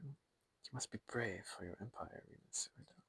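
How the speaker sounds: hushed unvoiced whisper. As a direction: whisper it close and urgent. Secret urging before a duty.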